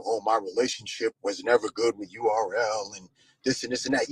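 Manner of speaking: mocking quote. Host mocking Beezy's excuse about URL, put-on voice. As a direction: sneer it like a lame excuse. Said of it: "where this whole thing come".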